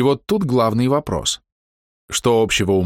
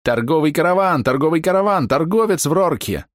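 joyful shouting; 9 semitones higher than usual. Retold or imitated. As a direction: yell it out in pure delight. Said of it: "радостных воплей".

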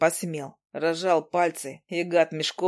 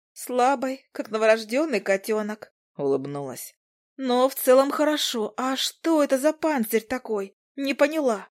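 put-on higher young woman's voice, sincere thanks; Izi's apology; 8 semitones higher than usual. Izi apologizing and thanking Demian in a higher voice.

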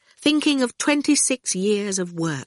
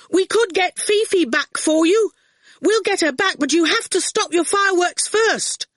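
high, urgent raised voice; calling out; 12 semitones higher, 4 dB louder than usual. A narrator's reading. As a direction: call out a bargain over the noise. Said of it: "Howard called out".

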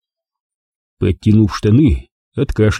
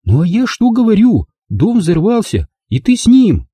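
Angela's voice; 11 semitones higher than usual. A narrator's reading. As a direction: spell it out to him in disbelief. Angela nods eagerly. (Анжела изумлённо настаивает, высоким голосом)

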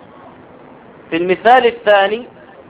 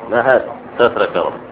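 dismayed mutter; 9 semitones lower than usual. Real, not acted. Teacher aside at a reading slip.

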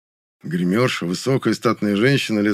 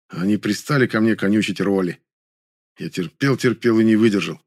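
ordinary delivery

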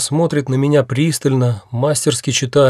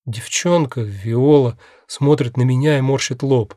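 plain reading voice

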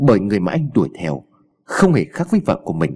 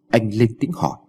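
ordinary delivery, nothing marked

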